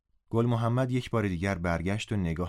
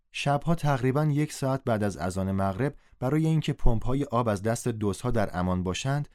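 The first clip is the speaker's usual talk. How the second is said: ordinary delivery, nothing marked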